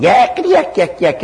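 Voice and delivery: high, chattering yak, yak, yak, yak; mocking yak yak. Preacher mimics the demon's chatter with a high, mocking yak yak. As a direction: mimic a pest that won't stop talking.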